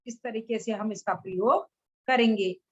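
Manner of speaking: normal talking voice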